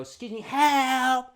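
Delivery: high, loud, slow pretend plea; mock cry for help. Imagined calling staff for help, hammed-up high shout. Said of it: "or go".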